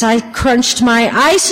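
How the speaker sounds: usual speaking voice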